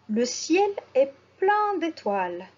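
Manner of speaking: ordinary talk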